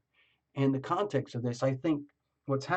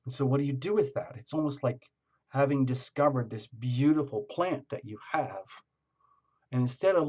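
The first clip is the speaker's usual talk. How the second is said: usual speaking voice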